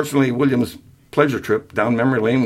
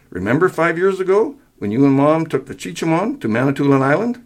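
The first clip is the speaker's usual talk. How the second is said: higher-pitched, insistent reminder; holding back a snicker; 7 semitones higher than usual. A narrator's reading. Son lists father's failings, suppressing a snicker. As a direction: press the case while keeping amusement down. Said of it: "willed the snicker out of his tone".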